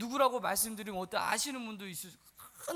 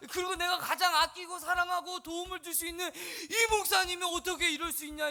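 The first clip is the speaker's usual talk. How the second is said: higher, indignant torrent of grievance; acted hurt and indignation; hurt protest; 5 semitones higher than usual. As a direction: pour out wounded complaint in one rush. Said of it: "이 큰 상처를 가지고".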